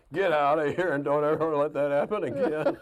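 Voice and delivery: imitated father's loud, high order through laughter; laughing scolding. Father trying to tell off naughty son, laughing. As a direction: try to scold while helplessly cracking up. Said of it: "he got to laughing".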